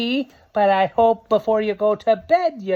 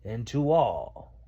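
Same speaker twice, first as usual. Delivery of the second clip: lower, slow and tender goodnight; fond farewell; 11 semitones lower, 4 dB quieter than usual. Acted. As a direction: let Santa's goodbye fade softly. Santa's farewell quote.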